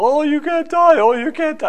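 high, wailing imitation of a frantic woman; hammed-up hysterical woman crying out; hysterical neighbor lady. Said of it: "she's just hysterical".

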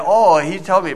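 ordinary talk, nothing marked

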